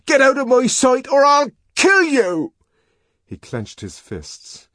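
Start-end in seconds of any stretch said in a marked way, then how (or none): 0.0-2.5 high, loud enraged bellow; furious shout, high and loud. Acted. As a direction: spit the insult out in fury. Savage threatens her.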